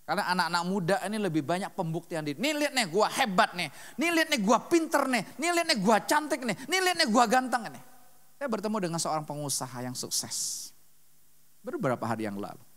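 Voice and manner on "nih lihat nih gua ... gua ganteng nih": higher, lightly boastful mock brag; mock the kid who shows off; show-off youth. Preacher mimics show-off youths bragging for attention, mildly higher.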